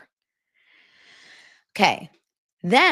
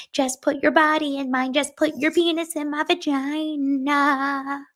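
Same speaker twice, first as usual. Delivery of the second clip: high needy bride; 11 semitones higher than usual. Retold or imitated. Bride begging groom for affection, high and needy. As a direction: coo at him like a clingy fiancée. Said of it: "constantly like".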